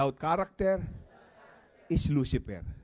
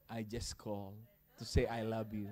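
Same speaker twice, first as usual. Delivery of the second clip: sweet husband; 5 semitones lower, 8 dB quieter than usual. Pretend. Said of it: "Yung sabihin mo sa kanya".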